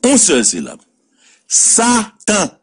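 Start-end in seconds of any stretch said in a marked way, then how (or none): 1.5-2.5 Satan said high and weighty; sermon-like emphasis; emphatic Satan. Shown as a demonstration. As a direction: hold up the word Satan. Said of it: "ça fait combien de syllabes".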